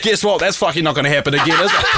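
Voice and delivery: high, giggling mock comeback; quoting his own retort to the girl while cracking up; laughing retort. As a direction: spit the line out through real laughter. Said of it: "I was like".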